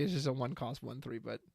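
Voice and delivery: ordinary talk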